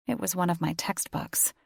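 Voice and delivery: ordinary delivery, nothing marked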